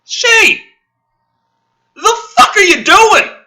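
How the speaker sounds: high, loud scolding of the writers; furious shouted tirade; angry yelling. Real, not acted. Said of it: "I spent so long yelling".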